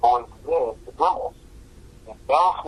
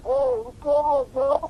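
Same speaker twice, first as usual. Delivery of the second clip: pitched-up imitation of himself; comic high-voiced self-impression; high mock greeting; 14 semitones higher, slower than usual. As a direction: squeak out the hello. Retells his own reply.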